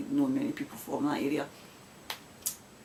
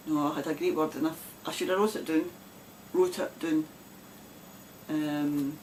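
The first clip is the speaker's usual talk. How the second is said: usual speaking voice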